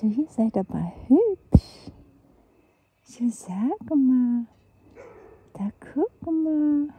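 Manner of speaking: loud, slow, drawn-out pet talk; sweet-talking. Real, not acted. Woman admiring kittens and getting their attention, sweet-talking. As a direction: coo out loud to coax little animals.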